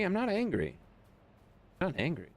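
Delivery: high, insisting not angry; comically high denial; mock denial. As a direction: protest way too much. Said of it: "when he gets angry".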